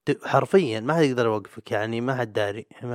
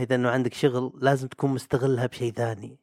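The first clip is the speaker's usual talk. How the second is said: ordinary delivery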